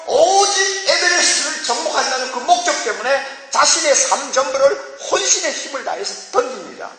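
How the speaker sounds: high-pitched, emphatic sermon delivery; impassioned preaching. Real, not acted. Preacher describing a climber's single-minded devotion, fervent high preaching. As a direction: hammer home total devotion to one goal.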